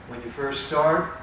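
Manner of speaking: ordinary talk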